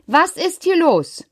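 lauter Ruf über den Lärm; rufen. Acted. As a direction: ruf in den Radau hinein. Ruft die Streitenden an. (laut rufend, höher)

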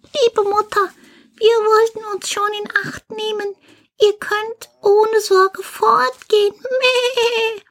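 put-on very high, bleating kid voice; little goat kids. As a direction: squeak like little goats reassuring their mother. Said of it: "Die Geißlein sagten". (piepsige, meckernde Geißlein)

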